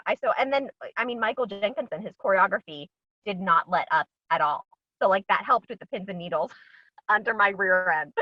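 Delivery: ordinary talk, nothing marked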